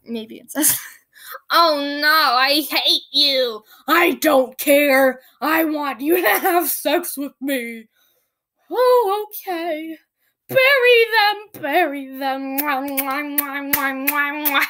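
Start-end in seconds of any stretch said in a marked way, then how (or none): 1.5-3.6 hammed-up, loud, drawn-out protest; mock Anne. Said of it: "Anne and him were like". 3.9-7.9 loud, hammed-up insistence; mock Richard. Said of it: "Anne and him were like". 8.7-10.0 high-pitched, hammed-up giving in; mock Anne. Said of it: "Anne and him were like". 10.5-12.6 mock dramatic order. Acted parody of the scene. 12.6-14.7 mock kissing noises. Mock kissing ends the parody.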